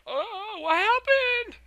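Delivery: very high, loud squeal of alarm; mock panicked shriek. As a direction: screech it out, hammed-up. Voices Peridot's shock.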